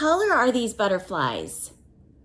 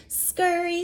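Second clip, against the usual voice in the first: mother ant, pitched higher; lightly voiced mother ant, higher; 7 semitones higher than usual. Reading to a child, mother ant calls higher. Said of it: "said the mother".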